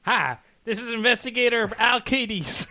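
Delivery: lightly Southern gangster intro; Southern accent. Retold or imitated. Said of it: "he's not Southern Newsies".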